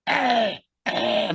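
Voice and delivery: high, odd, yelping "ah, ah" call; eerie high creature call; imitated creature call. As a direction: mimic a ghostly creature crying out in the trees. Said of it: "makes this weird".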